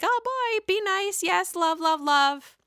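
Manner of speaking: high sing-song Teresa; lilting, peacemaking mock voice. Teresa leaving Jackie and Jennifer to make nice, high and sing-song. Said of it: "She's like".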